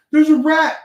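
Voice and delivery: high, loud panicked cry about a rat; mock alarmed shout. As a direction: imitate the family's rat panic. Retelling the family's alarm.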